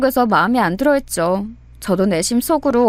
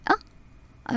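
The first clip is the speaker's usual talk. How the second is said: ordinary delivery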